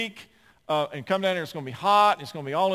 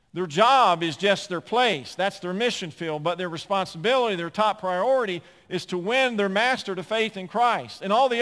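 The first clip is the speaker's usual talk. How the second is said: rising, louder pulpit exhortation, pressing each point; preaching at full force; 5 semitones higher, 6 dB louder than usual. Acted. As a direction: drive it home like a preacher building to a call.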